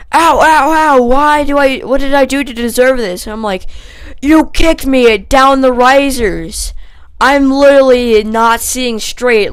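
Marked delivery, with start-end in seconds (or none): 0.1-3.2 high, loud imitation of yelping; hammed-up cries of pain; mock pained wailing. Imitates boy being hit with yearbook. 4.2-9.5 loud, high, drawn-out outrage; slow, raised-voice mock fury; retold angry accusation. Retold angry accusation while hitting.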